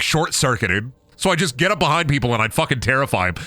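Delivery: usual speaking voice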